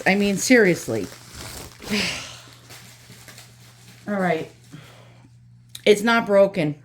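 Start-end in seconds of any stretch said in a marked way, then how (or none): 1.9-4.5 sighed out in a breath; breathy relieved all right. Real, not acted. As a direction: let it out on a sigh of relief. Relief after checking.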